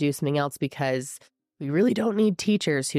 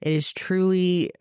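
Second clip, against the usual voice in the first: ordinary delivery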